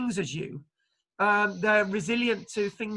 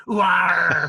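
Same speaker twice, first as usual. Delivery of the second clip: a hammed-up, loud pirate "arr"; pirate growl; 3 semitones lower, 7 dB louder than usual. Pirate giving directions to the Scots, loud mock pirate growl. Voicing pirates, listener laughs.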